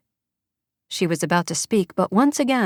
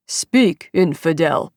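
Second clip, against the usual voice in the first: gruff captor; 3 dB louder, slower than usual. Acted. Hooded kidnapper orders the girl.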